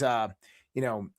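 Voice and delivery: normal talking voice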